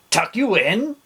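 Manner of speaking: bellowing. A narrator's reading. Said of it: "he bellowed".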